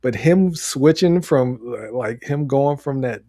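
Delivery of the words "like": usual speaking voice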